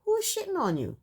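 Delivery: usual speaking voice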